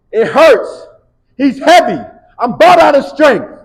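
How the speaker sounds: high, loud, straining complaint; strained player cry. Retold or imitated. Player to coach, high strained cry of exhaustion. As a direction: cry out like you're spent.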